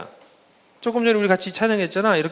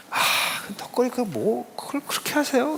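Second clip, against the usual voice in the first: teasing; 7 semitones higher, 5 dB quieter than usual. Retold or imitated. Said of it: "밤낮 놀려".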